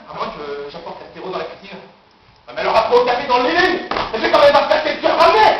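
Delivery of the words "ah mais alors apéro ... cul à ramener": loud, high outburst; high, exasperated objection; exasperated self-argument. Acted. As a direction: protest your own idea at full voice. Argues angrily with his own plan.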